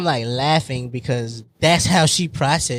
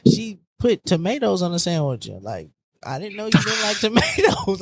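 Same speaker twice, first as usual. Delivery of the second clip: ordinary talk, nothing marked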